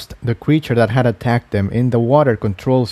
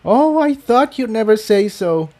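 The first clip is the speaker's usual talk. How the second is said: much higher, hammed-up relieved sigh; much higher mock-dramatic relief; theatrical Tiptoe; 11 semitones higher than usual. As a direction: play the drama queen, collapsing. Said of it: "in a theatrical faint".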